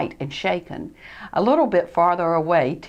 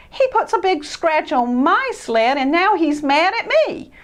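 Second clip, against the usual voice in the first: higher, louder aggrieved; indignant; 8 semitones higher, 4 dB louder than usual. Acted. Christopher venting at Ted to Freddie. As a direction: vent the grievance. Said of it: "in disgust".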